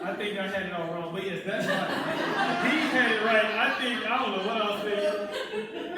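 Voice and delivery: loud, high, laughing admission; amused outburst. Real, not acted. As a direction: joke loudly over the laughter. Joking amid the room's laughter.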